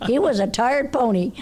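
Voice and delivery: loud, high stress on was; emphatic. Real, not acted. Repeating to friends that the pony was tired, high and loud. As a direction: press hard on was, then let it fall.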